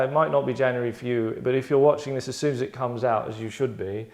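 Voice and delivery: usual speaking voice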